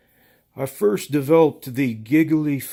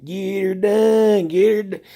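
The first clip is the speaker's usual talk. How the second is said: Larry the Cable Guy catchphrase; 7 semitones higher, 5 dB louder than usual. Retold or imitated. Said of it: "watching Larry the Cable Guy do the".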